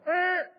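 high mock puppy whimper; imitated little dog yelp. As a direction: squeak like a scolded puppy. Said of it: "So he kinda went".

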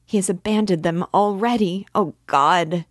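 high, loud, mounting despair; distraught cry. A narrator's reading. Sonia lamenting his abandoned mother and sister, crying out distraught. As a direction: build to a loud, anguished outburst. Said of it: "she cried".

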